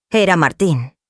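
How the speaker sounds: plain reading voice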